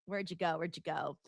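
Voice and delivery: quiet, low muttering; muttering to self. Real, not acted. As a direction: mumble it under your breath. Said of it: "searching for a comment".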